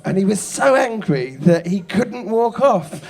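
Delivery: ordinary talk, nothing marked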